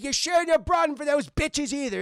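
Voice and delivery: put-on high, loud cantankerous old man; hammed-up irritable elder; grumpy grandpa.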